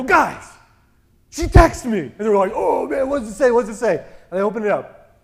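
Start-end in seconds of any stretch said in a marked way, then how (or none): 0.0-2.0 high, loud burst to the guys; urgent announcement. Retold or imitated. Telling his friends his ex texted, urgent and loud. Breaking text news to friends. 2.5-4.0 high, loud imitation of excited friends; eager clamouring. Friends begging to hear the ex's text, eager and loud.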